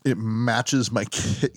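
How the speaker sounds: ordinary delivery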